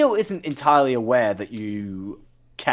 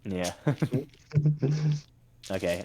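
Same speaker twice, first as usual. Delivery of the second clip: ordinary delivery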